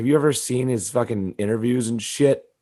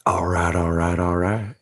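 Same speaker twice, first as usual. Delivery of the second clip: bad McConaughey; 4 semitones lower, 4 dB quieter than usual. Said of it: "That's a bad Matthew McConaughey".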